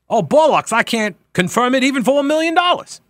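high-pitched, hammed-up British accent; exaggerated mock British voice; terrible British accent. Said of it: "That's a terrible British accent".

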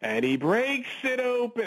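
mock-excited announcer, merely raising the pitch; high, drawn-out imitation of a forced big call; Jim Nantz. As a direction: stretch it out high, unconvincingly excited. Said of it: "But Jim Nantz will just".

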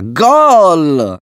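excited goal shout; goal cheer. A narrator's reading. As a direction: roar the goal. Celebrating the goal.

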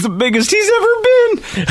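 laughing through the reading. Real, not acted. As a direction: crack up mid sentence.

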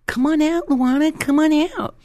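put-on high, singsong kids' call; mock calling out. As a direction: imitate kids calling a friend outside. Hoodlums calling child out to play.

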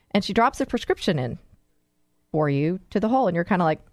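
normal talking voice

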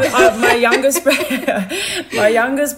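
can't get the words out for laughing; laughter swallowing the words. Real, not acted. Laughing while telling.

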